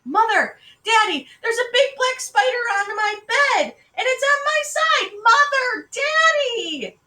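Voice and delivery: put-on very high, loud, panicked child; squealed little-girl panic at full pitch; screaming. A narrator's reading. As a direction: scream for your parents in terror. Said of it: "went screaming into her mother and father's room".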